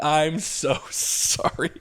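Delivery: slow, laughing through the sorry; can't keep a straight face apologizing; laughing apology. Retold or imitated. Comedian apologizing to his boss, slow and laughing.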